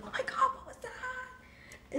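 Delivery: mom freaking out. Retold or imitated. Mom startled by the driver, breathy high panicked voice. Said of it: "she was like".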